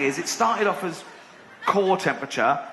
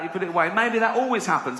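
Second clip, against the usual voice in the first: usual speaking voice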